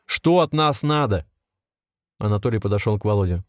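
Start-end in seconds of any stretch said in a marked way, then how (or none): 0.1-1.3 slow, raised-pitch demand; angry shout. A narrator's reading. Volodya presses his captors, slow mild shout. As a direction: demand an answer, drawing the words out. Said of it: "закричал Володя".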